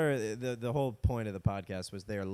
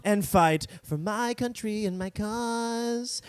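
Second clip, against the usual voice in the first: high, slow, hammed-up patriotic voice; mock heroic boy; 9 semitones higher, 4 dB louder, much slower than usual. Mocking a young Roosevelt who longs for the West, high and grand. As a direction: swell with boyish glory.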